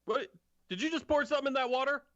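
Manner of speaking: fast, high-pitched suspicious guard; railway guard. Acted. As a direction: challenge a suspect in a high, quick voice. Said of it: "one of the railway guards, and he says".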